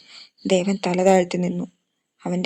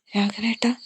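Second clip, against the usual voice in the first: trembling voice; 2 semitones higher than usual. A narrator's reading. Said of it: "നന്ദയുടെ ശബ്ദം വിറച്ചു".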